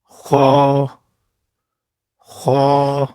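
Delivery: خا scraped from the upper throat; a gargled خا; rasping Arabic kha. Shown as a demonstration. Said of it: "گلی ہوئی آواز".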